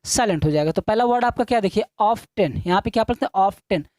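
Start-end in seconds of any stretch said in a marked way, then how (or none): 2.0-2.6 ऑफ टेन with the T sounded; wrong pronunciation. Shown as a demonstration. Teacher showing pupils the mistaken reading, wrong pronunciation. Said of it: "जो मैं पढ़ रहा हूँ ऑफ टेन ये गलत है". 3.4-3.9 ऑफ टेन with a sounded T; wrong pronunciation. Shown as a demonstration. Teacher repeating the word to be corrected, wrong pronunciation. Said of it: "जो मैं पढ़ रहा हूँ ऑफ टेन ये गलत है".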